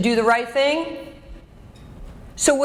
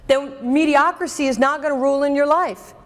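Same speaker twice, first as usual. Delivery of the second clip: normal talking voice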